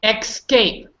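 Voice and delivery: "Escape" said as ex-cape, with a k sound before the s; wrong EX-CAPE. Shown as a demonstration. Teacher shows the wrong one, mispronounced ex-cape. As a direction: say Escape with an X at the start, EKS-kape. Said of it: "Here's the wrong, wrong one".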